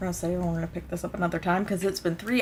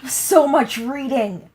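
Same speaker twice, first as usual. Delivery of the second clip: exasperated; 4 semitones higher, 13 dB louder, slower than usual. Real, not acted.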